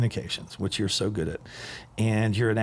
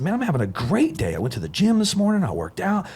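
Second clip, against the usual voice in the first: higher, louder enthusiastic self-quote; upbeat retold reply; 9 semitones higher, 4 dB louder than usual. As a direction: burst with pride about a great day. Retelling his upbeat phone reply.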